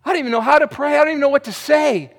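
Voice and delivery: high, loud desperate plea; crying out to God. Retold or imitated. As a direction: cry it out, pleading upward. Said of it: "They just cried out, said".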